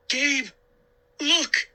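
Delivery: put-on high urgent girl's cry. A narrator's reading. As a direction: cry out to point something out. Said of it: "she cried".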